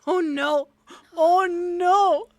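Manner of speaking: loud, high mock-dismayed cry; mock alarm. Game master reacting to a player's roll, loud mock alarm. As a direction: wail it out in exaggerated dismay.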